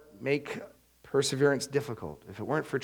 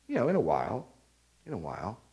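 noncommittal putting-off; 4 semitones lower, 4 dB quieter, faster than usual. Acted. Acted putting off, trailing away.

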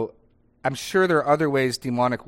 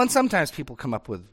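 ordinary talk, nothing marked

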